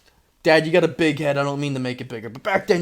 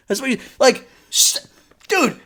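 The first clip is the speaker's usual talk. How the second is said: usual speaking voice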